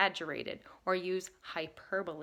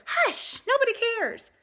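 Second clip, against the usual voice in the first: put-on high, snooty girl voice; dismissive girl; 13 semitones higher, 3 dB louder than usual. A narrator's reading. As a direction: brush him off from up high. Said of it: "Minnie Bell said".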